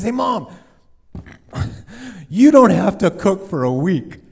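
put-on high, loud laughing kid voice; a boy proud of his gift; excited little boy. Boy telling mom with a laugh, high and loud.

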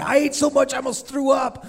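put-on high, proud kid voice; a boy bragging about overeating; excited little boy. Boy boasting to the crowd, high and eager.

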